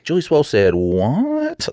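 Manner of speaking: slow, drawn-out disbelieving what; mock outrage. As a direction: stretch it out in fake shock. Mocking the left's outrage.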